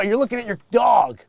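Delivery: ordinary talk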